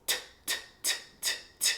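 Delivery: short whispered t, t, t; repeated t sounds. Shown as a demonstration. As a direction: tap out quiet t sounds. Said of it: "produce that T sound".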